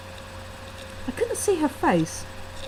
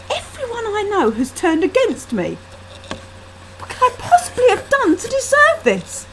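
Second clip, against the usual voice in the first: anguished; 10 semitones higher than usual. A narrator's reading. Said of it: "shaking with rage".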